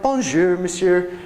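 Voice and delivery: lightly imitated Minnesota-accented French; French greeting in a northern Midwestern accent. Hotel staff greeting guests in French, Midwestern accent. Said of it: "with a, like, that M S P accent".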